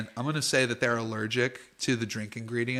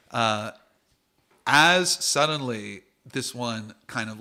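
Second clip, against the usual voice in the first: normal talking voice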